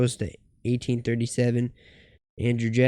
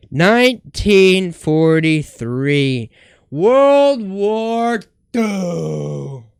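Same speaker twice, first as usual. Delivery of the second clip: mock mournful wail; 5 semitones higher, 10 dB louder, much slower than usual. Dramatic reading of grim year.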